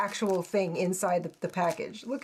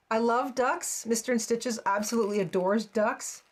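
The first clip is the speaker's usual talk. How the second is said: ordinary talk, nothing marked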